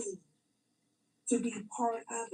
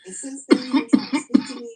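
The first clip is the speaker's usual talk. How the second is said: loud, pressed rant; loud telling of the feud; 14 dB louder than usual. Real, not acted. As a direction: keep the volume up. Ongoing shouted rant.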